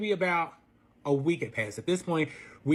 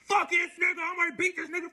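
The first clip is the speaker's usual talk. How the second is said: mock angry boyfriend; 11 semitones higher than usual. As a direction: ham up his furious rant, pitched high. Boyfriend voiced raging at family.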